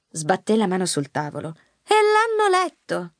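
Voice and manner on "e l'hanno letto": very high, emphatic punchline; triumphant mother. A narrator's reading. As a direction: deliver it with proud finality. Mother's triumphant punchline, hand slammed. (madre trionfante, voce acuta)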